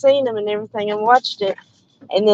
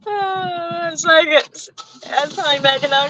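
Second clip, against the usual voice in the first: laughing; 5 semitones higher, 3 dB quieter than usual. Real, not acted. Laughing at herself.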